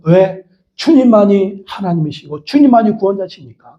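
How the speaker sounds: ordinary talk, nothing marked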